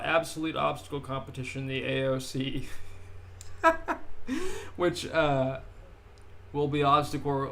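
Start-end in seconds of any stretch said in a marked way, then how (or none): 2.7-4.7 light laugh. Real, not acted. Reacting to own joke.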